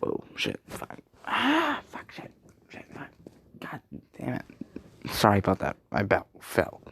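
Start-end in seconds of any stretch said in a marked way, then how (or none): 0.0-4.4 real hushed swearing under the breath; muttered cursing. Real, not acted. After his belt falls, real hushed muttered cursing. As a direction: curse quietly to yourself.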